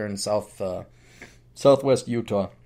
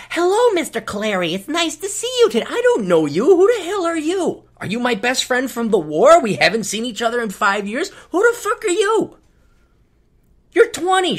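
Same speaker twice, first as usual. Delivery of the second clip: high chirpy teller voice turning profane; sweet on top, fed up underneath; fake-friendly teller; 12 semitones higher, 4 dB louder than usual. Comic mocking a bank teller's fake friendliness, high and chirpy.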